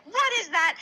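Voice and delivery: high-pitched mock alarm; freaked-out horse.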